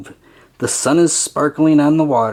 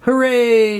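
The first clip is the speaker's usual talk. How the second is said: higher storybook cheer; drawn-out celebratory cry, moderately raised; cheering shout; 6 semitones higher than usual. A narrator's reading. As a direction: cheer it out as the crew. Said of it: "shouts the crew".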